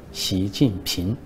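一字一顿，缓慢说出“习近平”; 像抖包袱一样拖慢说出“习近平”; 慢慢揭晓. Pretend. Punchline reveal of three characters. (讲段子抖出最后包袱，一字一顿)

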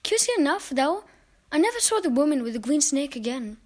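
usual speaking voice

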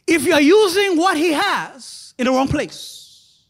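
loud, high preaching build; fiery preaching. Acted. Preacher warning the congregation, loud and high. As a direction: preach it with rising fire.

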